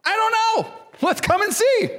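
very high, squeaky excitement; Philip's carefree answer. Acted. Preacher playing Philip inviting Nathanael along, high-pitched and eager. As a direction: toss it out in a high, eager singsong. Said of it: "I love Philip's answer".

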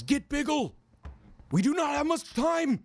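high, loud heroic call; desperate knight shouting across the field; urgent high shout. Acted. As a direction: bark it with no time to spare. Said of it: "and he says".

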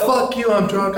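high-pitched drunk defiance, imitated; mouthing off. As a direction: act out a belligerent drunk friend. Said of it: "he was just mouthing off big time".

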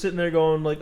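ordinary talk, nothing marked

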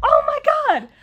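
high, loud fangirl squeal; excited outburst. Retold or imitated. Reacting to the star sitting near her, excited shout. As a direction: let it burst out star-struck. Reacting to seeing John Boyega.

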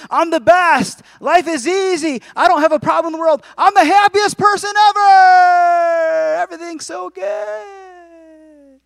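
high, loud over-the-top euphoria; hammed-up giddy boasting that stretches into a long squeal; mock elation. Preacher voicing deceiving emotions, mock ecstatic. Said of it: "emotions can deceive us the other way".

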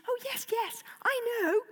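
high, soft little dragon voice. Acted. Toothless answering his owner in a high dragon voice. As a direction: squeak it like a small guilty dragon.

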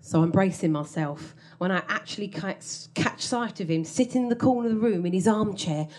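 normal talking voice

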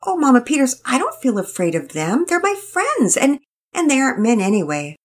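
put-on high, eager-to-please girl rushing on; tired young girl. A narrator's reading. Penelope explains herself to Mama Peters, high and earnest. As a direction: plead earnestly, a bit flustered.

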